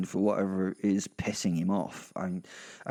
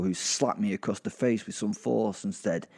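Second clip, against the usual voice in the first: plain reading voice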